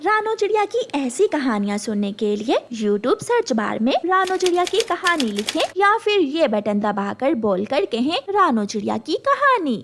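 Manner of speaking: loud promo voice; loud announcer. Acted. Announcer urging viewers to search, loud promo read. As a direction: announce it like a channel ad.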